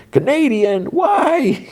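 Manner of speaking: shrieking high mock cry; mock scream. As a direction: squeal it like horrified guests. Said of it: "gasp and scream".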